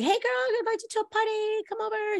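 high, lightly voiced girlfriend calling you over; friend's high, breezy party invite; bubbly girlfriend invite. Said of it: "your girlfriend, um, is like".

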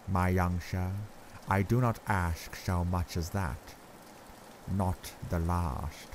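put-on grave old Dutch professor, accented, measured; Van Helsing's accent. A narrator's reading. Professor gravely telling Arthur Lucy is very bad, accented and grave.

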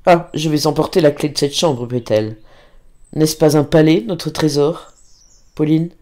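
ordinary delivery

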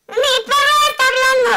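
high, loud astonished son with a laugh; amazed son. Acted. Son to mother, high amazed exclaim. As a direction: cry it out like a stunned kid. Son's punchline again.

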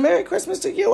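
very high, loud rising reply; chirpy reply. Retold or imitated. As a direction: chirp it back happily. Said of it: "I said".

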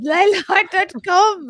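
high, loud Irish brogue; mock Irish accent. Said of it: "how you talk in an Irish accent".

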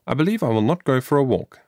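usual speaking voice

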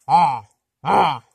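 ah through the nose like a honk; nasal O. Shown as a demonstration. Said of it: "nasal O".

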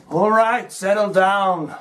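normal talking voice

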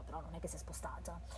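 quick whispered aside; whispering a doubtful comment; fast whisper. Real, not acted. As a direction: rush it out in a whisper. Whispered remark on the story.

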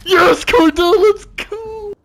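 high-pitched happy yell; excited cheer. Real, not acted. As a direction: cheer a teammate on. Teammate gets kill, cheering.

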